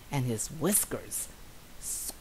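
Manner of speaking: usual speaking voice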